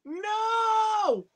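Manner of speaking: sudden high-pitched scream of no; high shout. Real, not acted. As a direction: cry out no in panic. Game danger, shout.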